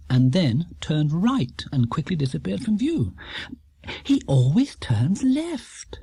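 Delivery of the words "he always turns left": slow, whispered puzzlement; slow hushed wonder; puzzled hush. A narrator's reading. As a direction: breathe it out, baffled. Mrs Brown, puzzled aside.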